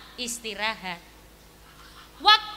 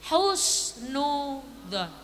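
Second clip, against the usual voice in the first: slow emphatic; 5 semitones lower, 4 dB quieter, much slower than usual. Acted. Father states his core belief.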